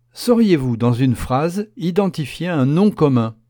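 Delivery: ordinary delivery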